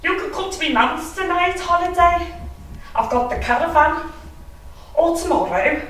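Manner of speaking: high, eager wheedling voice; pleading Kevin. Retold or imitated. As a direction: beg her to come, piling on offers. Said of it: "And Kevin says".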